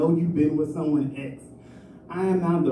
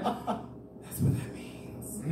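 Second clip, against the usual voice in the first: very slow, breathy whisper through laughter; laughing whisper; 10 dB quieter, much slower than usual. Retold or imitated. Shocked realization, whispered laughing.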